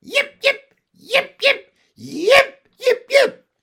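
very high, loud little yips; dog yapping. A narrator's reading. Andrew answering his mistress, high dog yapping. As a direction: yap like an excited small dog. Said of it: "Andrew yapped three or four times".

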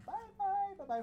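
high, loud shout of bye bye; screaming goodbye. Retold or imitated. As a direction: call down to dad from the fourth floor. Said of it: "just scream".

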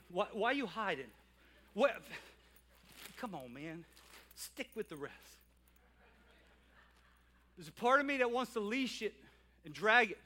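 usual speaking voice

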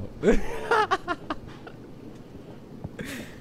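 breathless high-pitched giggle; high wheezing laugh. Real, not acted. Reacting to deep cat video.

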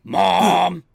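high, loud cry for Mom; mock-alarmed loud shout; shouted film quote. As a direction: yell it like a movie line. Acting out film line.

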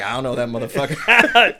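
loud disowning through laughter; laughing mock denial. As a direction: imitate a friend's emphatic denial while cracking up. Retold denial, cracking up.

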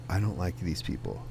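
muttered regret. Pretend. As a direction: say it quietly to yourself, deflated. Imagined quiet regret.

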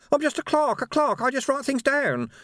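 very high-pitched little man's protest; frightened protest. A narrator's reading. Small clerk protests to the man who grabbed him, high frightened protest. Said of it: "he protested".

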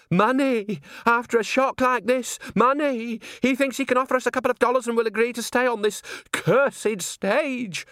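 hammy theatrical indignation; outraged performer. Acted. Outraged performer about money.

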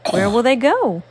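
ordinary delivery

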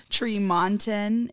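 Tremonton said slowly as TREE-mon-ton; pronunciation demo. Shown as a demonstration. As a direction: spell out Tremonton by sound, long "ee" first. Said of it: "how you pronounce our small city".

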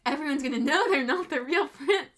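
hammed-up exasperation; mock alarm. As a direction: imitate a flustered schemer raising his voice. Old man's panic, retold.

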